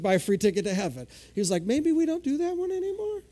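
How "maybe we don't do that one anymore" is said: very high, playfully timid voice; mock tentative suggestion. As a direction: float it up high like a cautious suggestion.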